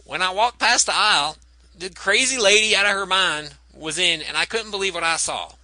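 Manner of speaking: folksy Southern witness voice; Southern accent. Retold or imitated. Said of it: "also in the [name] voice".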